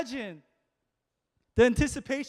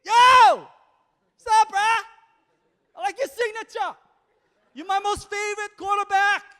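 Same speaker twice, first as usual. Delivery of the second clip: excited fan; 9 semitones higher, 3 dB louder than usual. Retold or imitated. Fan greeting a star quarterback.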